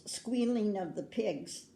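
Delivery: ordinary delivery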